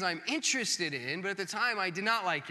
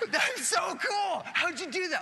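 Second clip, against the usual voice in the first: amazed excitement; 10 semitones higher, 3 dB louder than usual. Retold or imitated. Pastor showing his awe at kids' crafts, shouting with delight. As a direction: gush at the kid's craft. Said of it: "genuinely impressed".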